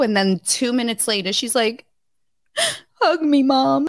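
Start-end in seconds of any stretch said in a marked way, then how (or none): 3.0-3.9 put-on high, lightly childish plea; imitating her little girl. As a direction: ask for a hug like a small child. Imitating her daughter asking.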